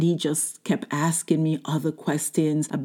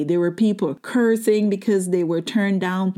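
usual speaking voice

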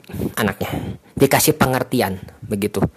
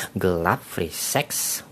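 softer and slow, lingering on each word; slow hushed repeat; 5 semitones lower, 8 dB quieter, much slower than usual. Real, not acted. To viewers, slowly repeating the mother's fear, hushed. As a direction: draw it out under your breath.

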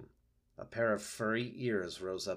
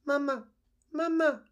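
very high chick voice calling; tiny peeping gosling; 7 dB louder than usual. Acted. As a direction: call for mama from inside the egg. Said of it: "said the egg".

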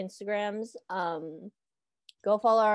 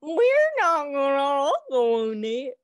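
laughing protest; 4 semitones higher, 5 dB louder, slower than usual. Real, not acted.

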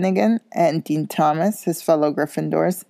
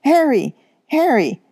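high, loud call across the street; yelling a name; 10 semitones higher, 5 dB louder than usual. A narrator's reading. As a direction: call out to a friend. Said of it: "someone yelled his name".

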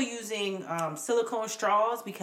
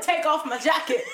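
laughing quote; 8 semitones higher, 5 dB louder than usual. Retold or imitated. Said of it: "She said".

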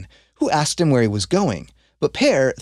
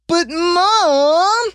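put-on high, pleading kid's whine; a son whining back at his refusing mother; whiny protest; 4 dB louder than usual. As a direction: whine it like a boy begging his mom.

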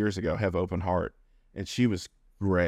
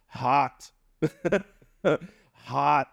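raw-throated croak; 7 semitones higher than usual. Retold or imitated. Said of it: "his throat's gonna be really raw".

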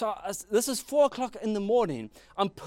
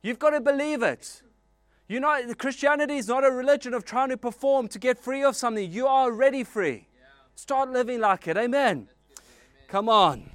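higher and louder, rousing sermon climax; full-force preaching; 6 semitones higher, 4 dB louder than usual. Acted. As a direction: preach it out, lift the congregation.

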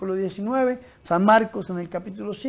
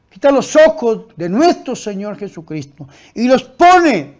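loud, driving sermon delivery; full-force preaching; 4 semitones higher, 10 dB louder than usual. Real, not acted. Preacher warning against looking at the problem, full-force preaching. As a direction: hammer each phrase at the congregation.

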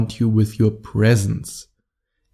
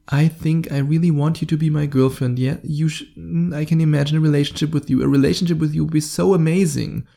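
higher, hesitant, gushing plea; over-exaggerated neediness; 4 semitones higher than usual. Shown as a demonstration. Guy hoping she'll be his girlfriend, over-exaggerated neediness. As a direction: hedge and stumble, then gush. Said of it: "I over-exaggerated now a little bit".

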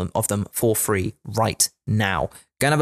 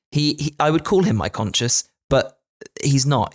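ordinary talk

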